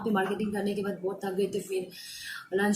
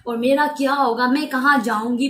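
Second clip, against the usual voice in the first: a loud, raised whine of a stranger asking; funny begging voice; 4 semitones higher, 9 dB louder than usual. Retold or imitated. Retelling the stranger who begged, loud mock whine. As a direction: mimic the pleading stranger, loud and high. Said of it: "वो भी बहुत funny way में वो बोल रहे थे".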